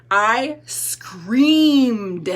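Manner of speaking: SCREAMED stretched long and loud; loud, swelling SCREAMED; dramatic emphasis. Real, not acted. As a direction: lean hard on SCREAMED, drawing it out. Stresses her own confession.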